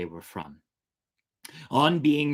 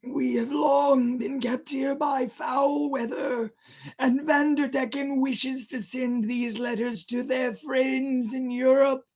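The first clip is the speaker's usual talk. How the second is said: high, slow, loud ghostly call; drawn-out wailing sailor; eerie stranger; 3 dB louder, slower than usual. Acted. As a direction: phantom seaman pleading to the crew. Ghost sailor answering the captain.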